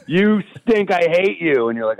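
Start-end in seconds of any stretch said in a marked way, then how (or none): 0.0-0.9 heckler yell. Retold or imitated. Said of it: "They're just in the stands like". 0.9-1.7 heckler yell. Retold or imitated. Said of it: "They're just in the stands like".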